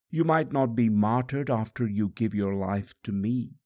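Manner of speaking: ordinary delivery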